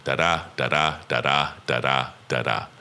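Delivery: da-da beats, low and soft, short then long; softly drummed iambic da-da; heartbeat rhythm. Shown as a demonstration. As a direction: tap out "da-da" like a heartbeat, unstressed then stressed. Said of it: "a heartbeat rhythm".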